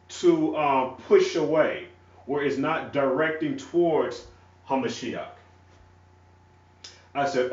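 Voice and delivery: ordinary talk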